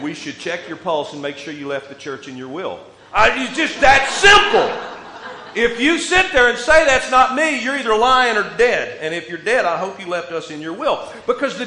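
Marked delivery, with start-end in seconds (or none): 3.1-9.0 louder and higher, laughing through it; loud preaching. Acted. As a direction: drive the point home with a laugh. Preacher punches point, laughing.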